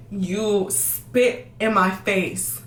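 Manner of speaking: very slow, word by word; slowed right down for emphasis; slow, pointed emphasis. Real, not acted. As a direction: draw each word out to let the insult land. Stressing the disrespect of being spat on.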